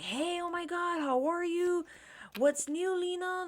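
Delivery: high-pitched, imitated gushy hello; gushing greeting. Guy greets her like an old friend, mock-excited.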